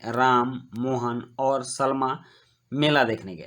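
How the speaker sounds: Ram set apart by a brief pause, Mohan और Salma slowly; short comma pause. Shown as a demonstration. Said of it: "पूर्ण विराम से कम विराम दिया जाता है". (राम के बाद थोड़ा रुककर, मोहन और सलमा)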